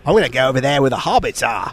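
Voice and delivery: butchered New Zealand accent, like a dwarven pirate; throaty, hammed-up attempt at a New Zealand accent; bad New Zealand accent. Shown as a demonstration. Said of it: "I sound like a dwarven pirate".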